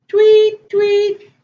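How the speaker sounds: high, loud chirped tweets; two piercing mock birdcalls; bird tweets. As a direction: chirp loudly like little birds. Story reader voices little birds.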